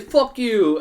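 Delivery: loud, high taunt; imitated defiant shout. Kid stealing a shoe, imitated defiant shout. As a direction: throw it out as a mock parting yell.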